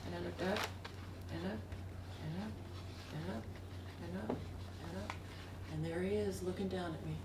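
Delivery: soft, suspenseful build of repeats; hushed awe. Retold or imitated. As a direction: let each step hang in a hushed pause. Awed story moment, looking up.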